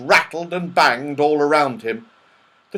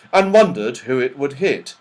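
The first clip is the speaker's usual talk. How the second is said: ordinary delivery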